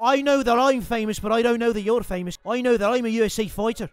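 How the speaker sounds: cocky mock Irish accent, lightly done; a gamer playing brash Irish fighter Conor McGregor; swaggering put-on Irish brogue. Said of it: "My name's Conor McGregor".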